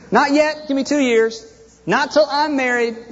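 high-pitched, hammed-up putting off; mock stalling plea. Mimics believers putting off Christ's return.

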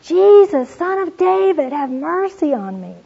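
high, loud pleading holler. Retold or imitated. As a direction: shout it over the crowd, begging. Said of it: "he hollers out".

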